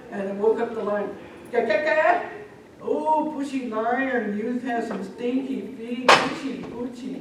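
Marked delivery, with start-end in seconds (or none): 1.5-7.2 high, loud, drawn-out revulsion; hammed-up scolding over a stink; disgusted complaint. Bear scolds lion for stinky feet.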